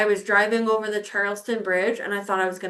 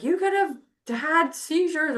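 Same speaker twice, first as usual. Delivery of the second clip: scolding doctor; 6 semitones higher than usual. Retold or imitated. Imitates the disapproving doctor's scolding.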